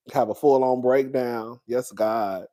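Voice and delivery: usual speaking voice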